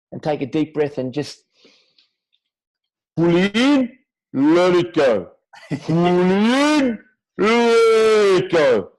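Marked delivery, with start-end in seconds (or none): 3.2-5.3 loud, high, drawn-out breathing coach call; Wim Hof impression. Showing the host how Wim Hof coaches breathing, loud and high. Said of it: "It's the perfect Wim Hof impression". 5.7-8.9 loud, high, very slow breath cue; Wim Hof impression. Doing Wim Hof for the interviewer, loud and drawn out. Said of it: "It's the perfect Wim Hof impression".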